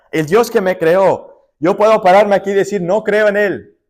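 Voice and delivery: preacher repeating Amos's warning, now thundering; preaching at full force. Acted. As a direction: proclaim it as a warning to all.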